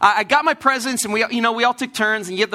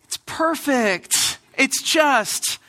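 feigned delight; 2 semitones higher, much slower than usual. Acted. Kid thanking parents for an unwanted present, feigned delight. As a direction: act thrilled by a gift you don't want. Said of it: "the thing you do when you get a Christmas present you don't want".

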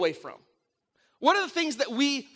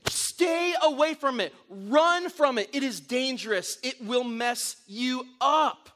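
slow urgent warning; slower than usual. Acted. Preaching a warning.

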